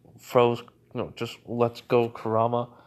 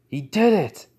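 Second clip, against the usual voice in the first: high, loud burst of joy; excited cheer; 7 semitones higher, 6 dB louder than usual. Acted. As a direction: cheer it out, thrilled. Naruto cheers in his head.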